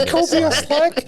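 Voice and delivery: high, whiny put-on voice; mock whiny boyfriend. Friend mocking the girl's boyfriend, high whiny voice. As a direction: mimic a wee guy whining.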